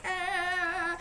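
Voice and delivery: crazed singsong "ah" from a forced grin; mock manic grin sound; psycho grin noise. Shown as a demonstration. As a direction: ham up a creepy grinning sound. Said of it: "Not psycho smile like".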